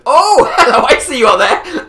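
chuckling, calling up to a hidden player; laughing tease. Real, not acted. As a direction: laugh as you let him know he's spotted. Teasing hidden player spotted above.

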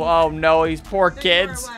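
usual speaking voice

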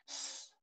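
"S" as a bare voiceless hiss; S sound. Shown as a demonstration. Teacher shows how letter C begins, hissed "S". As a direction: sustain the consonant "S", no vowel. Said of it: "la C empieza con".

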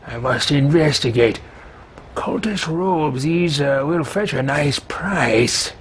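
slow, haughty appraising tone; pompous character voice. Pretend. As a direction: inspect loot like a snob. Loots body in character voice.

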